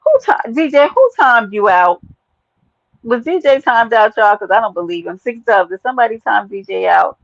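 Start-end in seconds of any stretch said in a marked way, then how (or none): 0.0-4.3 loud, high who timed you out; loud call out. Real, not acted. Streamer demands to know who timed out DJ, loud. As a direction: demand an answer loudly. Calling out in chat.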